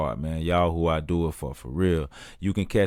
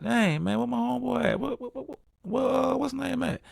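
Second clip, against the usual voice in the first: much higher, anxious searching voice; looking around the club for your buddy, pitched way up; high worried calling. Retold or imitated. Searching club for missing friend.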